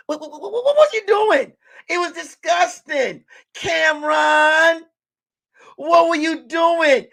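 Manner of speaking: high, loud, stretched-out disbelief; mock outraged yell. Hammed-up pundit yelling at [name] over last night. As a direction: scold him like a TV pundit losing it.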